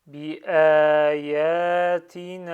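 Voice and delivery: "Bi ayatina" very slow and loud, long a vowels; emphasised prolongations. Shown as a demonstration. Teacher reads in one go, "Bi ayatina" with prolongations stressed. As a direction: stretch each long a in "Bi ayatina". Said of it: "J'essaie de souligner les prolongements".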